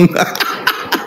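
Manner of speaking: soft, breathy chuckle; amused laugh. Real, not acted. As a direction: chuckle quietly to yourself. Amused at silenced opponent.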